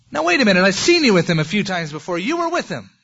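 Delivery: high and loud mock accusation; loud accusing woman. Servant woman shouting at Peter in the courtyard, accusing. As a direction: push him, you know he's lying.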